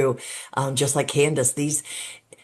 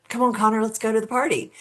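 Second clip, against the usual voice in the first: mock call out to a friend, higher pitched; retold friendly yell; 7 semitones higher, faster than usual. Driver calls Connor over to go to the party, mild retold yell. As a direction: imitate a teen calling a pal over, without real shouting. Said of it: "he yelled to Connor".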